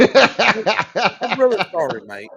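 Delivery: laughing mock British accent; mock British accent through laughter. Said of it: "so it's gonna go like this".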